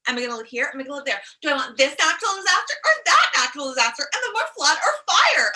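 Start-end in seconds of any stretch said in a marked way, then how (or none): 1.4-5.5 louder, higher exasperated questions; comic exasperation rising; mock dilemma. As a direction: build up the absurd choices.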